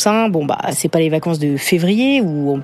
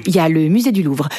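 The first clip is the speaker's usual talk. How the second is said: ordinary delivery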